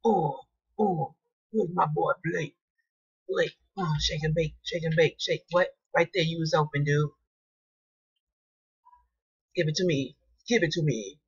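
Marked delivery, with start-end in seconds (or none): none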